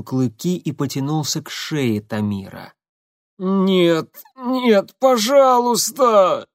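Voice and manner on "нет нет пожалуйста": высокая мольба, протяжно; умоляет в ужасе; стон ужаса. Acted. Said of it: "в ужасе простонал шофёр". (в ужасе стонет)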